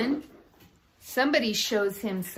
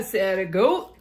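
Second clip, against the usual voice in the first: ordinary delivery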